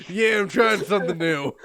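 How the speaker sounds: loud, put-on character voice; big, loud reply in character; changed character voice. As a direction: try out a loud new voice. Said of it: "your voice really changed".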